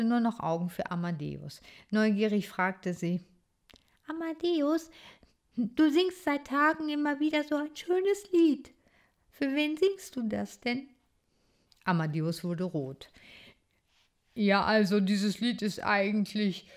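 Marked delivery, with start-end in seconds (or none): 4.1-10.9 a shy little titmouse speaking; young bird girl. Acted. Clotilde voiced by reader. 14.4-16.7 embarrassed stammer. Acted. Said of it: "stotterte er verlegen".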